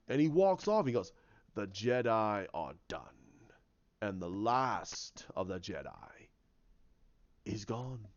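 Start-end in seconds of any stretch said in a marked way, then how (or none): 1.5-8.1 low, slow villain proclamation; sinister villain. Acted. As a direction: pronounce doom slowly in a low voice. Villain declares victory.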